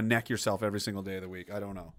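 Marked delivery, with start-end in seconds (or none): none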